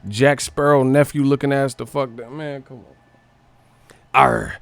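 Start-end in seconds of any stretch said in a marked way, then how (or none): 4.1-4.6 a hint of a pirate, spooky warning; light pirate threat; mock pirate. Still riffing on the pirate name, a hint of pirate voice. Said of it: "sound like a pirate".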